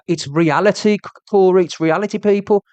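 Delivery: normal talking voice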